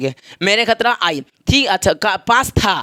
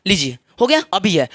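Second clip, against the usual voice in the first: ordinary delivery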